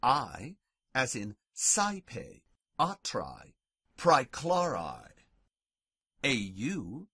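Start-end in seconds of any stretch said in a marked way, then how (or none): 0.0-0.6 I said like English "eye"; "eye" for I, slowly; AE diphthong. Shown as a demonstration. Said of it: "A E, pronounced I". 1.6-5.1 saipe, atrai, praeclari with "eye" for AE; saipe, atrai, praeclari, each set apart with an eye glide. Shown as a demonstration. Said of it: "pronounced I".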